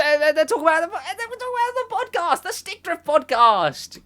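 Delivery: high-pitched, gushing mock excitement; hyped-up imitation. Imagining the later podcast chat, mock excited. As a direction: put on a high voice, stretching the last words.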